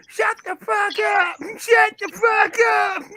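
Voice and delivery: high, slow yelled shut up; mock angry siren. Mocking American ambulances to friends, high slow yelling. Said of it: "ambulances are like Big Red".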